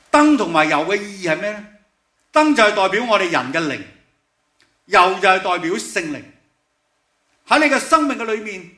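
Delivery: high, loud, urgent sermon voice; fervent preaching. Acted. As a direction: preach it to the back row. Preaching at full force.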